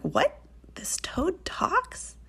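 slow, incredulous question rising high; surprised. A narrator's reading. Girls discover that the toad talks, astonished question. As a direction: draw it out in high disbelief. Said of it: "surprised them".

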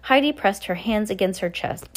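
ordinary delivery

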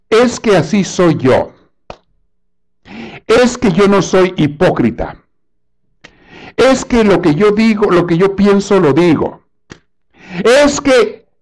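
hammed-up high, loud excuse-making; mock someone making excuses, pitched high; frases de excusa. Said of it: "usa frases de disculpa, de excusa como estas". (imitando excusas con voz aguda y exagerada)

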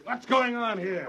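furious discovery of the couple; angry demand. Acted. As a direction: catch them in the act. Catches couple kissing.

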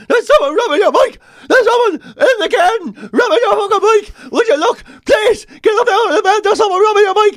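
high, loud, panicked squeal of a dog raising the alarm; frantic dog voice. Retold or imitated. Dog yelling at owner about the stolen bike, high frantic. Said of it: "the dog is basically going".

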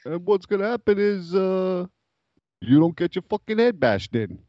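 high-pitched Italian-American wiseguy threat; Italian mobster. Acted. Italian guy warning hospital interviewer, menacing wiseguy voice. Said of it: "his job interview went something like this".

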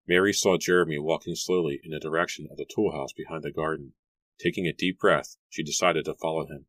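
plain reading voice

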